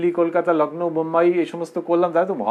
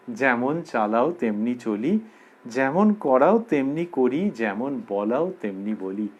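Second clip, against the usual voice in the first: slow, lower, measured chant of the verse; slow verse recitation; 4 semitones lower, slower than usual. Acted. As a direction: recite each line slowly like a prayer.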